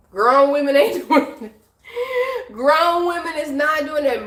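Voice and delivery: laughing through the words. Real, not acted. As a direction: laugh while saying it. Laughing mid-sentence.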